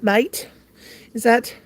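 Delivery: normal talking voice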